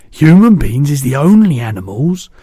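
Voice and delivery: a big kindly giant chatting; booming gentle giant; the BFG. A narrator's reading. BFG voiced.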